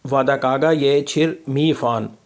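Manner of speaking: ordinary talk, nothing marked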